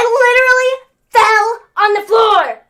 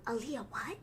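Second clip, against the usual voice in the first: breathy confused whisper; clueless whisper; 8 semitones lower, 22 dB quieter than usual. Retold or imitated. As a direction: mutter it lost and dazed. Clueless Dominic voiced.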